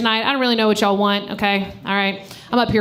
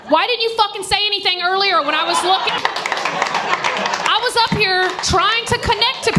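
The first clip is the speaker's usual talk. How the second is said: much higher, hammed-up amazed gushing; excited high mock praise; 10 semitones higher than usual. Real, not acted. Comic to granddaughter in crowd, high excited gushing. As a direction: gush over her with mock delight.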